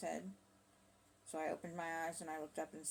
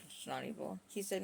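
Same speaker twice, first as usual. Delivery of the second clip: normal talking voice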